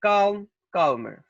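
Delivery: slow, careful drill of calm and calmer; teacher models calm and calmer slowly for the class; slow model words. Shown as a demonstration. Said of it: "As in the examples".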